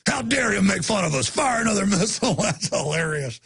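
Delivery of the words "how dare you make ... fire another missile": mock-indignant film villain; put-on voice, indignantly ordering an attack; Team America impression. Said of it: "they're putting up a Team America where he's like".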